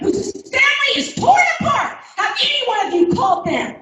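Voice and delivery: high indignant challenge; indignant question. Real, not acted. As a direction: demand an answer, voice jumping up.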